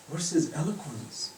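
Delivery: normal talking voice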